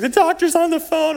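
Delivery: high, tearful wail imitated; mock crying. Crying wife to husband with the phone, high mock wail. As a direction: imitate a wife blurting through tears. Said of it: "and she's crying".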